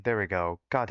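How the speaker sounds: ordinary delivery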